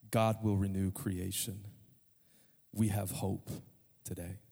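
low, measured words of hope; hushed, grave sermon close; solemn preaching. Real, not acted. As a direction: let each phrase settle with long pauses.

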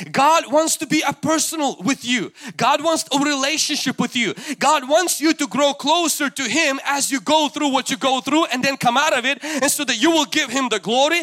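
loud, high, driving sermon; full-force preaching. Real, not acted. Preacher urging the congregation toward God, full-force fervour. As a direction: preach it at the top of your voice.

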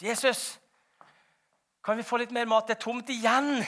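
high, loud, urgent begging; acted disciples clamouring for help; pleading call. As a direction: call out needily to the master. Disciples calling to Jesus again.

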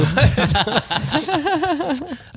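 long, high-pitched laughing fit; laughter. Real, not acted. Players joking before the quiz, laughter.

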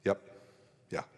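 short cold grunts of reply; curt one-word answers. Retold or imitated. Sulking at his fiancée on the phone, curt. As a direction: sulk through the answers. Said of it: "Giving her these short one-word answers".

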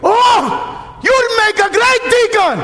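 priest from Tanzania. Retold or imitated. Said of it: "he's from Tanzania".

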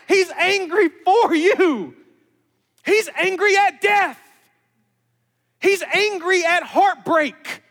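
high, loud, hammered declarations; fervent preaching. Real, not acted. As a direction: drive each phrase home to the congregation. Preaches at full force.

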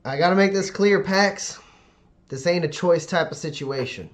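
doctor firmly telling Pax there is no choice; firm insistence. Acted. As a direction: lay down the law to Pax.

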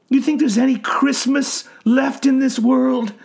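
high, rising bitter rant; agitated old man. A narrator's reading. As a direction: rant at the doctor, despairing.

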